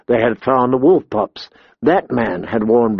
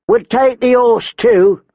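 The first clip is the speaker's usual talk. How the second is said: high-pitched robber woman; demanding woman brigand; 10 semitones higher than usual. A narrator's reading. Short woman to boy she robs, demanding. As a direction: claim it as loot, pitched high.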